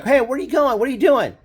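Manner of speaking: high, puzzled shout-like call to the outfield; a pitcher who can't believe what his fielders are doing; baffled pitcher. Retold or imitated. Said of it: "And so the pitcher's like".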